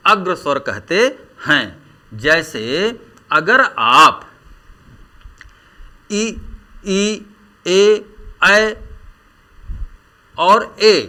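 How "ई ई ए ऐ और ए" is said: ई, ए, ऐ said apart and higher, each held; front vowel demo. Shown as a demonstration. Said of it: "का उच्चारण करें तो आप महसूस कर सकते हैं". (ई, ए, ऐ को अलग-अलग साफ़ और ऊँचे स्वर में बोलना)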